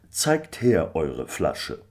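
slow, lordly gentleman's voice; a curious squire, drawing it out; landlord. A narrator's reading. The landlord questions Michael, lordly. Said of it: "sagte der Gutsherr".

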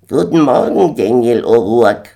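high, loud squawking bird voice; old gander greeting. A narrator's reading. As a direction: honk out a hearty hello. Alter Gänserich begrüßt Daniel.

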